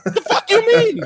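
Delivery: very high squawk of disbelief; mock outrage. Acting a store owner refusing a rival, squawking incredulously. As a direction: blurt it incredulously, pitch shooting up.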